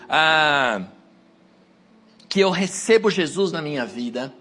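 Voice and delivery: normal talking voice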